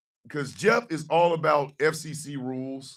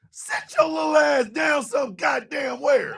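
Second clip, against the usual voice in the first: laughing shout; 8 semitones higher than usual. Real, not acted. Yelling through laughter.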